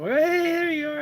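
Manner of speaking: high, loud sing-song greeting; calling out. Retold or imitated. Aunt calls out to the girl at school pickup, loud and high. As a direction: call across the schoolyard to catch her.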